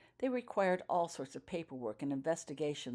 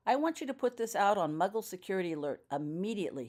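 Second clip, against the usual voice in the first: firm order to his subordinate, raised a little; commanding; 5 semitones higher, 5 dB louder, faster than usual. A narrator's reading. Wizard ordering Daniel to act at once, commanding. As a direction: give the order with full authority. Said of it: "he commanded".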